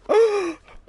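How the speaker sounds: sharp startled gasp; loud high gasp. Acted. Voices heroine's shock.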